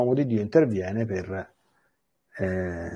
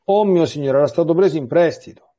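higher, louder dismayed cry; 5 semitones higher, 6 dB louder than usual. Acted. A woodcutter crying to Elisha over the lost axe, alarmed. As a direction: call out in alarm to your master. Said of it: "Egli gridò".

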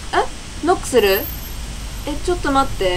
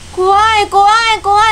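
higher, louder repeated frightened cries; real fear; 7 semitones higher, 3 dB louder than usual. Real, not acted. Player scared entering the room, high frightened cries. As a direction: whimper out the fear.

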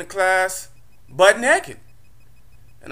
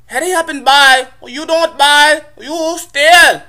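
mock Korean accent; 9 semitones higher, 6 dB louder than usual. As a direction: bark it like a pushy Korean shopkeeper. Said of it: "Korean store owner".

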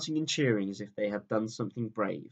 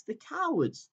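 sobbing; 12 semitones higher than usual. A narrator's reading. Susan weeping at the cruel crowd, a high sobbing cry. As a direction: let cowards break upward into a sob. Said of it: "sobbed Susan".

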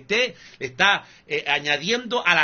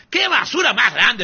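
loud, higher, disgusted exclamation; indignant outburst; 6 semitones higher, 6 dB louder than usual. Real, not acted. Preacher raging at false pastors, loud disgusted outburst. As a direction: spit out your contempt.

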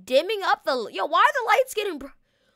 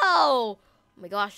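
voice crack; 11 semitones lower, slower than usual. Real, not acted. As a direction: voice cracking on a slow rise. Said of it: "the voice crack".

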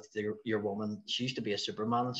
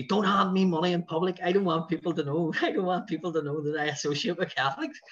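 higher, urgent broad Belfast voice; Lee, broad Belfast accent; 7 semitones higher than usual. Retold or imitated. Tour man refusing money in public, high broad Belfast accent. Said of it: "he had the broadest Belfast accent".

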